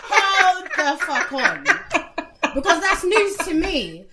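high shocked laughing outburst. Real, not acted. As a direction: squeal it out in disbelief, laughing.

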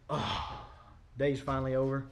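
breathy, worn-out exhale; tired end-of-day sigh; weary sigh. Pretend. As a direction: slump into the chair exhausted. Acting out tired evening slump.

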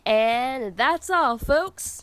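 slow, high, loud cartoon outro "that's all, folks"; cartoon sign-off. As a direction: sign off the story like an old cartoon. Cartoon sign-off closing the story.

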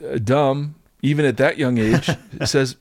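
ordinary delivery

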